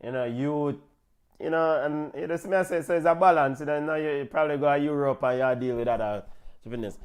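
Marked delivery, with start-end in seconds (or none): none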